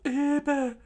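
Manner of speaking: mangled high cry, no teeth; teeth knocked out. Acted. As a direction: slur it through broken teeth. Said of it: "as his teeth fell out".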